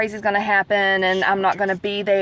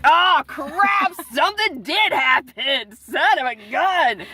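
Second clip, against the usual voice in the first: high, drawn-out mock wail; dramatic dismay; 4 semitones higher, much slower than usual. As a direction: wail it slowly, hammed-up horror. Retelling her dismay at the news.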